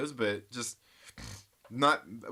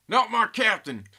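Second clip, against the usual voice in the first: louder, higher Southern accent, hammed up; 9 semitones higher, 9 dB louder than usual. Laughing at his own bit, loud Southern accent. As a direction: belt it out in a hammy Southern accent. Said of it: "in Southern accent".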